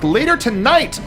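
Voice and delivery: ordinary talk, nothing marked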